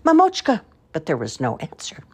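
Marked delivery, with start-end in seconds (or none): 0.0-0.6 put-on high, loud child calling for her mother. A narrator's reading. Lost girl calling for her mother, high and loud. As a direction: cry out across the field for Mama. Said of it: "Varya called".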